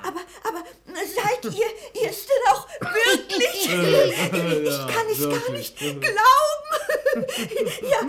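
laughing and crying together; tearful joyful laughter; laughing into sobs. Acted. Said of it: "lachte und weinte gleichzeitig".

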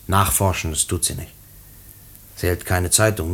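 ordinary delivery

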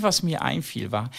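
normal talking voice

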